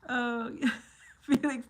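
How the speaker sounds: ordinary talk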